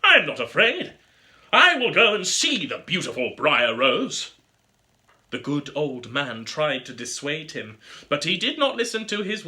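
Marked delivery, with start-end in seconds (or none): 0.0-4.3 youth's brave words. A narrator's reading. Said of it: "Then the youth said".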